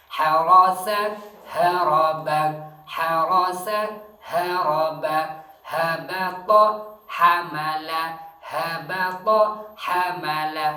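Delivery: Harasa and haraba loud, throat H against light H; loud pronunciation drill. Shown as a demonstration. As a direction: chant the pairs loudly for students. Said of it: "H lagi dengan H". (latihan dua H, keras berulang)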